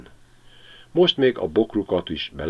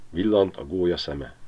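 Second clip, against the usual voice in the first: ordinary delivery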